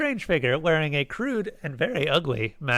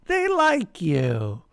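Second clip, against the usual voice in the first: slow, higher-pitched happy coo; child's pleased singsong; happy exclaiming; 5 semitones higher, much slower than usual. A narrator's reading. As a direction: draw it out, delighted and sweet. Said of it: "she exclaimed happily".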